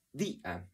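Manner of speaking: día said slowly as two beats, dí, then a; split into syllables. Shown as a demonstration. Teacher proving where the stress falls, día split as dí-a. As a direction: break día apart after the stressed i. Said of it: "aunque tiene un diptongo".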